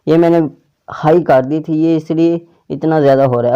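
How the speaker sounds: ordinary talk, nothing marked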